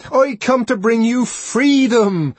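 loud, high, slow declaration; proclamation. A narrator's reading. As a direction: proclaim freedom. Savage proclaims to the crowd.

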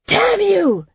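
high, loud curse; angry yell. A narrator's reading. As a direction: yell it in fury. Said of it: "Jaren yelled".

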